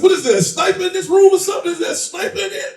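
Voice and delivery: high-pitched incredulous questions; acted bewilderment. Acts out bewilderment on stage.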